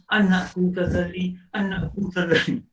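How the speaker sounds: a lightly voiced father, drawn out and mournful; father sighing his son's name, slow and mournful; father's lament. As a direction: call the son's name slowly like a grieving father. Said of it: "Ayah hanya".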